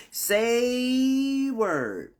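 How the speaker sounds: high, long-drawn SAY, dropping on word; hammed-up reaction. Waking up with aches, hammed-up high drawn-out reaction. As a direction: stretch SAY out high and let it fall. Exaggerated repeat of reaction to pain.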